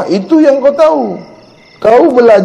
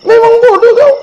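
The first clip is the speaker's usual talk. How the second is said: a much higher, strained cry of "Memang bodoh kau"; scornful shout; 13 semitones higher, 3 dB louder than usual. Real, not acted. Teacher shouting at a touchy pupil that he really is a fool. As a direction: throw the insult out hard and high. Preacher scolds the listener.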